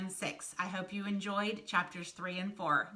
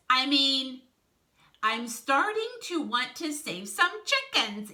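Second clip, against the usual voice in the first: loud, higher, over-emphatic correction; backpedalling Wolf figure; 6 semitones higher, 9 dB louder than usual. A narrator's reading. Wolf hastily corrects his slip about being hungry, backpedalling.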